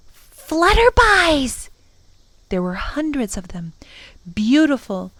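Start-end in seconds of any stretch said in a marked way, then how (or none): 0.1-1.7 put-on high, long-drawn child's cry; excited cry. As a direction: stretch the word out in high delight. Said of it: "she cried excitedly and pointed".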